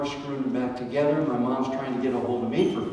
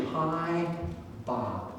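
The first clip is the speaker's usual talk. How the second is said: pain in his voice; 7 dB quieter than usual. Retold or imitated. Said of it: "I could hear the pain in my dad's voice".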